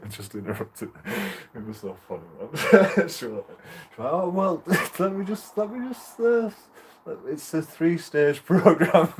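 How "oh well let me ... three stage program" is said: high, laughing imitation of the doctor; mock doctor, laughing. Mimicking the doctor's three-stage program, high and laughing. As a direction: giggle through her careful answer.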